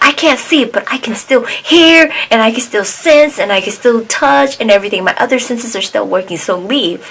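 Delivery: high, insistent mock rant; retold yelling. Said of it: "she started yelling at him".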